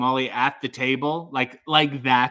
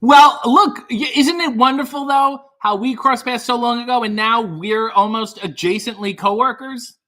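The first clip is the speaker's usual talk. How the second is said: gushing excited; 7 semitones higher, 7 dB louder than usual. Real, not acted. Host gushing to caller.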